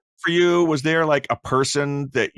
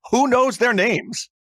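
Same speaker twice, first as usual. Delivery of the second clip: incredulous; 10 semitones higher, much slower than usual. Retold or imitated. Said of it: "They said".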